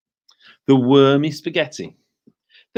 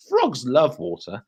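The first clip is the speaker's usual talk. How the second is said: ordinary delivery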